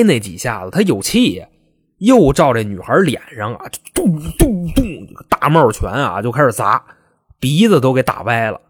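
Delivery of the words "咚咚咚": high, hollow mouth-made thuds; three spaced sound-effect punches; imitated thudding blows.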